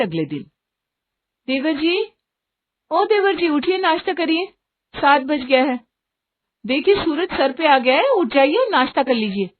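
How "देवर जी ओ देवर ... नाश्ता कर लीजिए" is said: high, insistent calling; raised, high-pitched urging to get up; wake-up call. Acted. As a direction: rouse a sleeping brother-in-law. Sister-in-law calls him to wake up.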